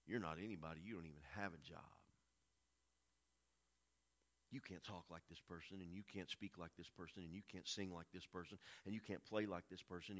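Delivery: low, hushed belittling inner voice; quietly chip away at the listener's confidence; Satan's discouraging voice. Acted. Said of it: "Satan will begin to work on you".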